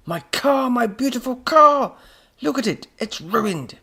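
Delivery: high, loud, distraught cries; anguished wail. A narrator's reading. Professor pulling his hair over ruined car, anguished wail. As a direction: wail in despair at full pitch.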